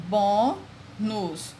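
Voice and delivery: "bônus" split into bô, then nus; syllable by syllable. Shown as a demonstration. Teacher giving an example of syllable counting, "bônus" slowly split. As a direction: stretch "bônus" out with a gap between its two syllables. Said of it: "separando as palavras quanto ao número de sílabas".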